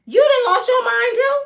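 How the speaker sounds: high, loud mock indignation; retold angry outburst. Father turns on mother over the meeting, retold anger. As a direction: act out an incredulous telling-off.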